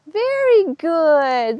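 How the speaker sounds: very good sung out high and slow; high slow delighted praise; excited praise. Real, not acted. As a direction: cheer her on, drawn out. Horse steps forward on cue.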